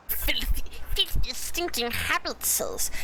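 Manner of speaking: slow hissing Gollum voice. Acted. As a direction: spit it out like Gollum. Gollum quote from Lord of the Rings.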